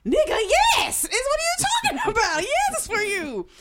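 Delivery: loud, high, incredulous outburst; exasperated yelling. Retold or imitated. Woman telling her suspicious ex the gift is his, exasperated yelling. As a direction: yell it with a laugh breaking in.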